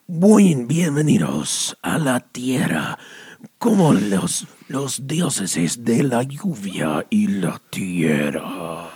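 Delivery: a dramatic Spanish welcome, sinking to a whisper; hamming up a mystical tour guide, fading to a hush; La Lluvia imitation. Doing the imitation his friend asked for, dropping to a whisper. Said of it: "did you do the imitation of, uh, La Lluvia".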